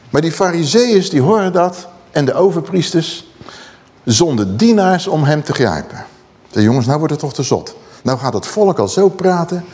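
ordinary talk

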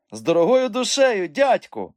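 high, loud, showy acceptance; theatrical. A narrator's reading. Boy rising to address uncle, theatrical. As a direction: accept grandly, like a little showman. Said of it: "малий театрально встав".

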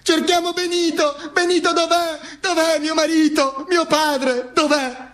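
high, sustained calling voice; searching relatives calling. Acted. Family searching for a missing husband and father, high plaintive calling. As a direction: call out in a high, drawn monotone.

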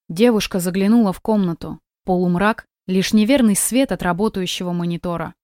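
ordinary delivery, nothing marked

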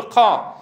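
قا with a heavy puff of breath after the ق; exaggerated breathy qaf. Shown as a demonstration. Teacher mimicking those who overdo it, strongly aspirated قا. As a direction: overdo the air released on قا. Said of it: "وبعضهم يزيد فيه فيقول".

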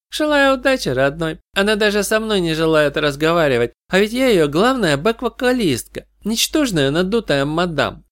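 high-pitched, scornful backup singer; scornful snort of words. A narrator's reading. Backup singer sneers about the star to a visitor, scornful. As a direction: pitch it up, dismissive and catty. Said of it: "громко фыркнула".

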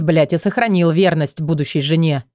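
ordinary delivery, nothing marked

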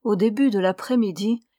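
ordinary delivery

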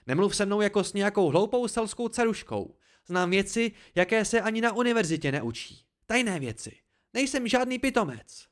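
high, loud, offended tirade; indignant. A narrator's reading. Dena refuses to be talked down to, indignant. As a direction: defend your pride angrily. Dena's indignant reply in quarrel.

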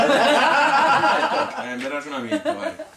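high laughing burst. Real, not acted. Elderly woman laughing at her husband's old excuse. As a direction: laugh it out, amused at the memory. Laughing at his soldier story.

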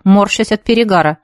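usual speaking voice

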